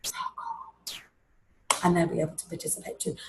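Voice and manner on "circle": breathy whispered word. Retold or imitated. Child whispering the answer to friends, whispered. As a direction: whisper it like a classmate. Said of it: "hear their friends going".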